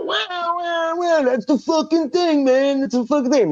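very high-pitched mock wailing; high, excited caller impression; Nuno. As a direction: stretch it out in a high imitation voice. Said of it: "And Nuno go".

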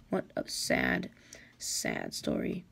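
slow, low, mocking sadness; drawn-out mock lament; mock pity. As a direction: sneer through fake sympathy. Villain mocks with fake pity.

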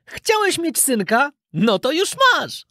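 put-on high, bright boyish puppet voice, calling out; a newly carved puppet greets its dad; cheerful wooden puppet boy. Puppet boy greets his father, calling out in a high boy voice. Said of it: "zawołał".

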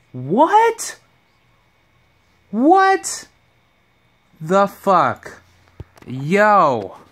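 very high, loud disbelieving yelp; shocked outburst. Real, not acted. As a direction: react in total disbelief. Shocked by the news.

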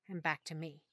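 ordinary delivery

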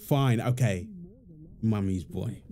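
ordinary talk

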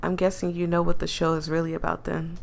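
ordinary delivery

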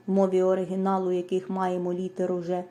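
normal talking voice

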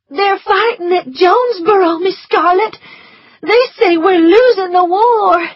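put-on high, tearful servant girl voice; Prissy near tears with bad news; frightened Prissy. A narrator's reading. Prissy blurting war news to Scarlett, high and tearful.

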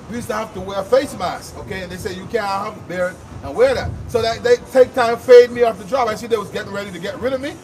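ordinary delivery

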